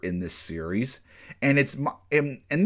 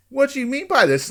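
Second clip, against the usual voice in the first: startled question; 13 semitones higher, 4 dB louder than usual. Retold or imitated. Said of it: "And he's like".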